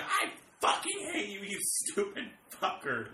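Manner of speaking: loud, drawn-out hateful yell; mock furious outburst. Showing the owner turning on the dog, mock fury. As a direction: flip to screamed hatred, stretching each word. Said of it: "like, within seconds".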